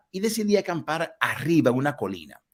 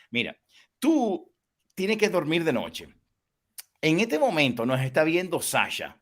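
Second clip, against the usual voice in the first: ordinary talk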